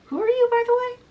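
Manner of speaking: high-pitched acted question; curious questioning. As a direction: ask it high and puzzled, as a story character. Hidden boy questions the stranger.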